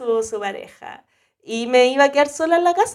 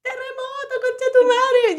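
retold scream. Sleeper running out into the earthquake, screaming it high. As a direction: imitate a dream-dazed scream, pitch way up. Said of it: "Yo gritaba así".

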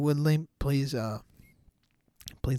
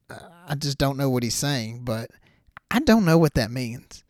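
usual speaking voice

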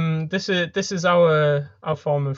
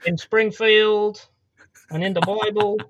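bad Trump impression; 3 semitones higher, 3 dB louder than usual. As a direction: declare it like a badly faked American politician. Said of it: "do a really bad impression".

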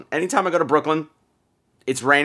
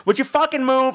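higher mock snap at FUCKING; blunt New Yorker; 11 semitones higher than usual. A hypothetical rude commuter, lightly mock snapping. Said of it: "If she had just gone".

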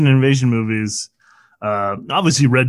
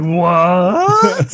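surprised; 4 semitones higher, 4 dB louder than usual. Retold or imitated. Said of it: "He's like".

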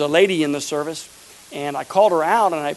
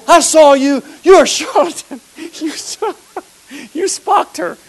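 high, loud mock accuser; hammed-up heckler; 9 semitones higher, 6 dB louder than usual. Preacher mimicking the heckler who yelled at him, high mock accusation. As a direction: ham up the angry heckler.

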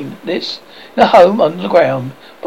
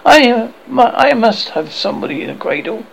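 almost tartly; 7 semitones higher than usual. A narrator's reading. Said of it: "almost tartly".

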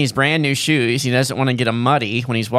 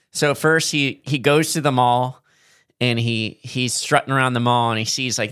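ordinary talk, nothing marked

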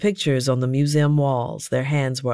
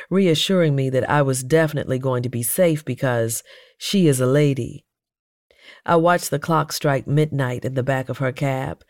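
usual speaking voice

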